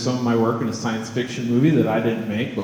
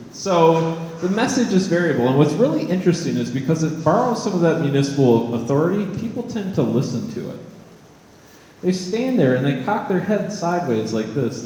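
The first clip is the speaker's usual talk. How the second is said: usual speaking voice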